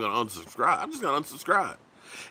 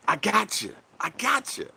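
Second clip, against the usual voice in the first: teasing gotcha; 6 semitones higher than usual. Pretend. Teasing April Fools prank.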